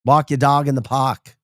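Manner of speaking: hammed-up Boston "pahk"; Boston accent. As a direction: drop the R's like a Bostonian.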